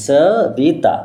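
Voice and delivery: সবিতা broken into স, বি, তা; three separated beats on সবিতা; split into syllables. Shown as a demonstration. Teacher showing three syllables, সবিতা said as স-বি-তা. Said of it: "এখানে তিনটে syllable আছে".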